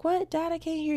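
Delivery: imitated calling out. Daughter calls back to dad across a busy street, imitated high call.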